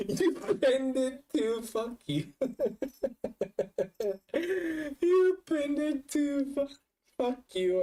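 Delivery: high squeal of laughter through the words; helpless laughing. Real, not acted. Streamer finding the embarrassing clip pinned, helpless laughing.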